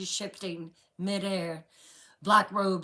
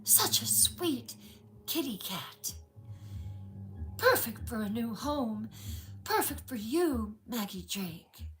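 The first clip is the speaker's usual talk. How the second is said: put-on eerie sweet girl; 4 semitones higher, 3 dB quieter than usual. A narrator's reading. Witch in teen girl's voice.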